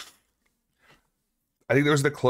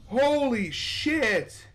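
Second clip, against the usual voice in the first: shocked; much slower than usual. Real, not acted. Horror game jump scare.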